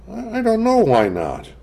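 put-on high, hesitant little girl; young girl voice. A narrator's reading. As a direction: stammer it like a shy child. Child evading mother's question.

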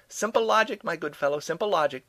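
high, loud call across rooms; shouting back. A narrator's reading. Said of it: "Doe shouted back".

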